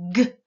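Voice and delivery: G as a hard voiced sound, not the letter name; isolated sound. Shown as a demonstration. Teacher contrasting G with K, isolated sound. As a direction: voice a short hard G alone. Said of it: "Là, c'est".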